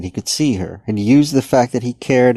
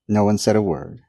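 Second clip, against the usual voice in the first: ordinary delivery, nothing marked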